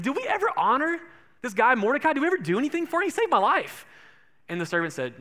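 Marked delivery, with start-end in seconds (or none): 0.0-3.9 high, eager, rising questions; a king suddenly fired up to reward his saviour; excited king. Acted. King recalling the man who saved him, eager and high.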